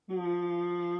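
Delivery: long steady hum on the out-breath; humming bee breath. Shown as a demonstration. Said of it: "humming it out".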